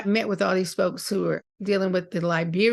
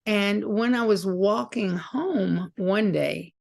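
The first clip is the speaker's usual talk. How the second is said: normal talking voice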